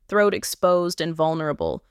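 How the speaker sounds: ordinary delivery, nothing marked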